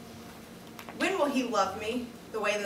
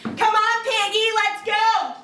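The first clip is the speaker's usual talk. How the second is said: put-on child taunting; 11 semitones higher, 5 dB louder, slower than usual. Child voiced taunting mother.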